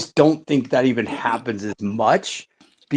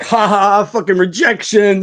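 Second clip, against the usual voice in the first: higher, louder taunt of a rejection; mocking yell; 7 semitones higher, 4 dB louder than usual. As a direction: jeer at him like the guys in the bar. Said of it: "They're yelling rejection".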